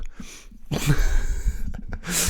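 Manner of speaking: breathy high-pitched laugh; high laughter. Real, not acted. Laughing at the friend's AK joke, high giggle.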